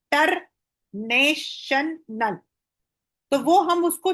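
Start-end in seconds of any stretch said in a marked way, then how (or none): 0.1-2.4 international drawn out slowly, syllable by syllable; dictating slowly. Shown as a demonstration. Teacher dictating a word to young children, slow and clear. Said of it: "तो हम उनको बोलते हैं".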